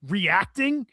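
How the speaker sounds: ordinary talk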